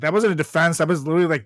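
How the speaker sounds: ordinary talk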